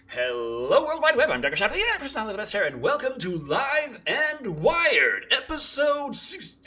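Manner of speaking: high, loud hammed-up announcer; show host intro. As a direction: belt the intro like a showman. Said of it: "show intro announcement".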